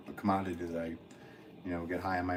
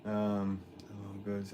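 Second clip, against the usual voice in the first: ordinary talk, nothing marked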